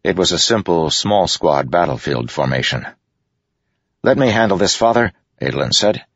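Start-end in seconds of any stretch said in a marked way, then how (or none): 4.0-5.1 higher, eager urging; urgent plea. A narrator's reading. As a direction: press your father to let you take it. Adolin urges father before fight.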